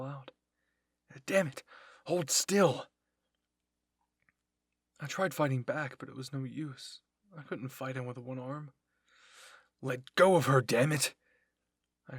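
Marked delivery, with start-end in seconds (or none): 1.3-2.9 high, loud exasperated yell; angry shouted command. Acted. Attacker orders struggling captive to hold still, angry shout. 9.8-11.1 very loud, forceful demand; angry shout. Acted. Friend demands the captive's release from above, angry shout.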